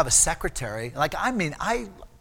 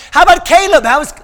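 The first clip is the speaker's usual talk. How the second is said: loud, high, exuberant shouted question; Brother Vic; 11 semitones higher, 15 dB louder than usual. Retold or imitated. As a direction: boom out the friendly question. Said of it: "that's the way he talked".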